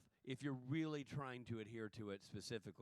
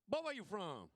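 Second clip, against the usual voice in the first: put-on Boy, where you from? run together as one word, higher and louder; 7 semitones higher, 4 dB louder than usual. Shown as a demonstration. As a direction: slur it into one Southern word. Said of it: "it's one word".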